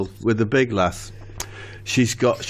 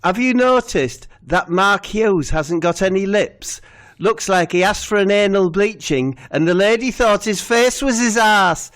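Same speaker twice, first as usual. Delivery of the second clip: higher, bouncy Steve voice; voicing Steve; 8 semitones higher than usual. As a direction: play Steve telling his gag.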